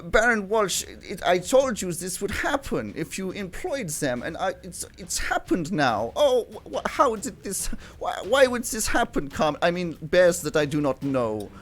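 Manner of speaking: high, stammering put-on bear voice; badly faked dismay, halting and high; theatrical bear character. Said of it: "And he says".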